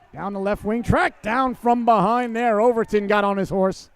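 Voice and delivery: drawn-out, punchy live call; excited play-by-play. Real, not acted. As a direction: stretch out the chase as it happens.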